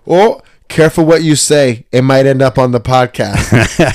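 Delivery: a mother's teasing warning; man doing a woman's voice. Retold or imitated. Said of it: "my mom goes".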